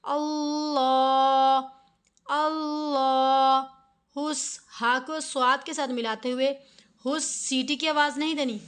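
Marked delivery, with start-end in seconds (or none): 0.0-3.7 अल्लाह with a thick लाम held one alif long; heavy drawn lam. Shown as a demonstration. Teacher showing the full lam, slow recitation. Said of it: "लाम को मोटा पढ़ेंगे".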